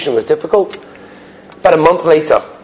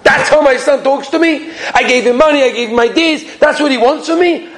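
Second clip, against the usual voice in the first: high-pitched indignant outburst; acted indignation, pitch raised; upset father; 8 semitones higher than usual. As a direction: protest in wounded outrage. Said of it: "Then he was so upset".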